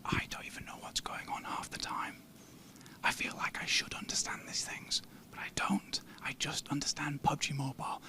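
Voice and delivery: hushed whisper, barely voiced. Real, not acted. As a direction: whisper so the editors can't hear. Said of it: "We better be quiet here".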